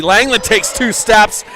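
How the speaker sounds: high, loud call building to a yell; excited play-by-play shout. Real, not acted. Announcer calls Langland's two-step kill, shouting excitedly.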